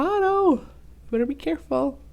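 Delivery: mild worried warning. Acted. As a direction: call out in alarm.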